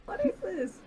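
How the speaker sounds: squeaky high crab voice; a little crab asking, pitched high; curious crab voice. Acted. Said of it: "crabs checking it out, like".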